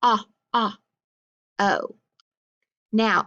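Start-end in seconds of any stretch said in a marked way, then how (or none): 0.0-0.8 "ah, ah" as two separated short-vowel sounds. Shown as a demonstration. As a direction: sound out "ah" twice, apart. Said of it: "Then I hear".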